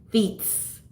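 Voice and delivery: "feets" with an added s; husband's Louisiana pronunciation. Retold or imitated. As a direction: teasingly quote "feets" as dialect. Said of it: "so there's an accent there".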